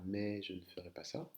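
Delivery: usual speaking voice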